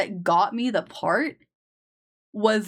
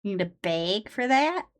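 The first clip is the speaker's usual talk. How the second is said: Midwestern accent. Shown as a demonstration. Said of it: "God, it's awful".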